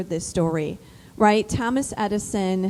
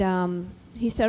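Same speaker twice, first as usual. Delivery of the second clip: usual speaking voice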